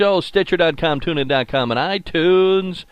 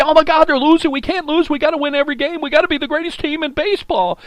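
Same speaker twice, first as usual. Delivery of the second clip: mock panicked fan; 11 semitones higher, faster than usual. Host mocking overexcited Brewers fans, hammed-up panic. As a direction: play the frantic fan who can't bear a loss. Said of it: "I wanna scream and yell and say".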